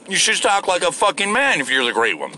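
normal talking voice

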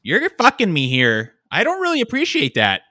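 loud, high, hammed-up Minnesotan indignation; southern Minnesota accent. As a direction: play an aggrieved customer in a Minnesotan accent. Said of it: "southern Minnesota is a lot more nasally".

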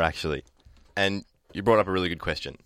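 ordinary delivery

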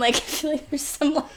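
normal talking voice

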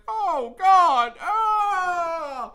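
hammed-up anguished cry, high and loud; mock stroke cry. Jerry in his office, hammed-up anguished cry. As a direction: wail like an owner clutching his chest. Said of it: "practically having a fucking stroke".

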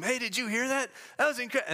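high-pitched impressed listener; mock amazement to the person next to you; imitated excited aside. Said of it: "comment to the guy next to him".

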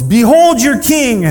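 loud, high, drawn-out proclamation; yell. Acted. Pilate from the judge's seat to the crowd, yelling. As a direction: bellow it out to the courtroom. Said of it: "and yells".

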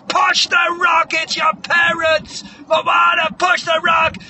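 slow, loud, high bellow; hammed-up trainer's drawn-out yell; urgent coaching shout. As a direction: hammer each word at the struggling athlete. Trainer urging Moana to push.

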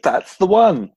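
high, loud, thrilled; high excited mock cheer; mock delighted approval. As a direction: squeal it like you're delighted. Mock church folk approving sermon.

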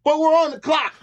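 high, protesting coworker; protesting bandmate. Pretend. Bandmate objects that they're on the clock, high and pleading. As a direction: plead with him to stay at work.

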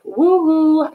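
high mock cheer; sarcastic woo-hoo. Real, not acted. Mock cheer at OnlyFans ban.

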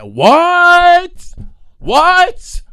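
high, loud disbelieving yell of What; shocked shout. Retold or imitated. Retold reaction to porn offer.

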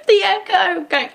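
shrieking laugh, then words through the laughter; high cackle. Real, not acted. Cracking up at the scene.

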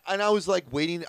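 ordinary delivery

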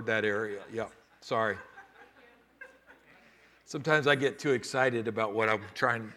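ordinary delivery

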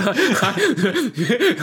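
high burst of laughter; cracking up at the story. Real, not acted.

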